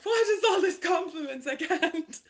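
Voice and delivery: high, loud, laughing disbelief; overwhelmed squeal at praise; flustered inner voice. Retold or imitated. Her own brain reacting to compliments, squealing high. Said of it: "my humble brain is like".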